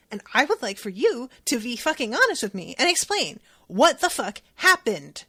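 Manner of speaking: loud, forceful telling-off; retold angry confrontation; angry demand. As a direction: push hard, refuse to be lied to. Kit confronting Nita, demanding honesty.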